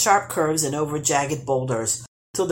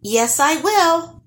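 high, slow little gnome voice; piping up; 12 semitones higher, 5 dB louder, slower than usual. Acted. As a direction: pipe up cheekily from the trunk. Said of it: "piped up Nils".

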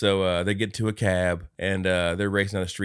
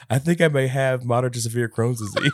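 higher, louder, laughing commercial read; ad voiceover; 5 semitones higher, 5 dB louder than usual. Retold or imitated. Scrooge eating, laughing through a mock drug ad line.

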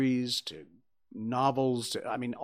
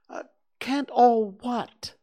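much higher, slow and breathy bear; puzzled Pooh; 11 semitones higher, 5 dB louder, slower than usual. A narrator's reading. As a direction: wonder it aloud like a small bear.

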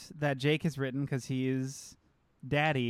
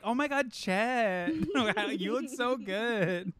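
gushing fan; 5 semitones higher, slower than usual. Retold or imitated. Imitating fans gushing over Chet.

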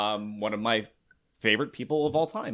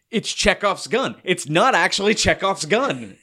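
higher, drawn-out excited quote; retold excitement; 7 semitones higher, 3 dB louder, slower than usual. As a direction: relive the excited realization, slowly and higher. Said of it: "I started just getting excited".

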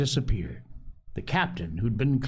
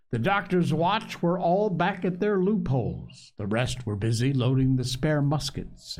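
ordinary delivery

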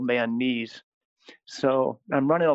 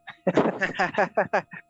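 amused laugh; 6 semitones higher than usual. Real, not acted. Priest laughing at tricking the running kids, amused laugh. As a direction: run of high giggling laughs.

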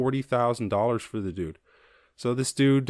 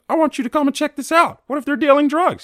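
much higher, louder mock Karen squeal; shrill Karen voice; 10 dB louder than usual. Karen demanding police check out barbecue, high loud mock squeal. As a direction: squeal it like a panicked busybody. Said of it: "She's like".

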